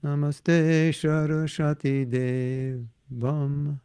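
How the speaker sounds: "Namaste Saraswati Devam" drawn out slowly, chanted; careful slow recitation of "Namaste Saraswati Devam"; slow mantra recitation. Shown as a demonstration. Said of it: "we were given both options".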